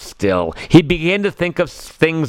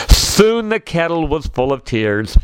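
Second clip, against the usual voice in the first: ordinary delivery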